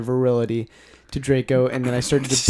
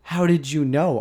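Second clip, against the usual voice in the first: mock surprise; 5 semitones higher, 6 dB louder than usual. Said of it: "I asked in a surprised way".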